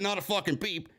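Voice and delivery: ordinary delivery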